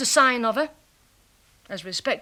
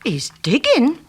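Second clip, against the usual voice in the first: louder, insistent repeat; excited exclamation; 3 semitones higher, 6 dB louder than usual. Acted. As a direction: hammer the discovery home. Excited spying on neighbour.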